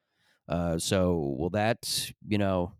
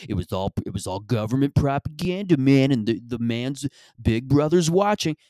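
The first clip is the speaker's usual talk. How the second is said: mock-agitated conspiracy nut, building louder; conspiracy guy; 4 semitones higher, 5 dB louder than usual. Comic imitating a conspiracy guy on propaganda, mock-agitated. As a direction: get more worked up and emphatic as you go. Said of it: "trying to sound like this conspiracy guy".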